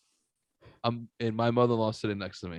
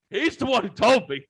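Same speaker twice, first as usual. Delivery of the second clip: mock kid voice; 10 semitones higher, 4 dB louder than usual. Kid snitching in retold story.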